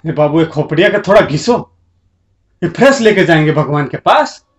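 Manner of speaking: ordinary talk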